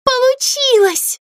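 high, slow, delighted squeal; drawn-out cry of triumph; excited cry. Acted. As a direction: dolls squealing that it worked. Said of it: "закричали куколки".

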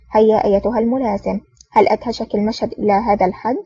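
ordinary delivery

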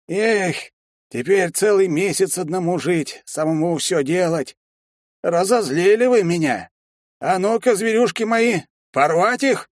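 sorcerer sends his beasts to attack; villain voice. Acted. Villain grieves then orders attack.

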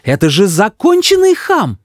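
high, indignant verdict; exasperated high-pitched mother; mother shouting. A narrator's reading. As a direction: cry it out in outrage. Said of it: "кричит мама".

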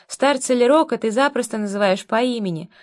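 ordinary delivery, nothing marked

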